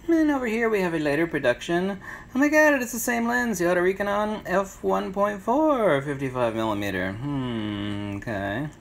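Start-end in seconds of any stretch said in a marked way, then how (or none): 2.3-6.0 mock surprise. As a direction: gasp it out like a big discovery. Finds the identical second lens.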